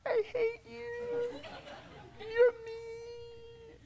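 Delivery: put-on high, slow, teary little-boy whine; mock sobbing child. Boy crying at dad who took the binoculars, mock sob. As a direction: sob it out like a sulking seven-year-old.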